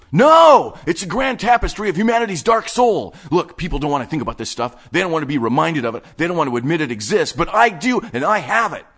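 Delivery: high, loud fevered rant; manic outburst. Acted. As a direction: proclaim it like a prophet.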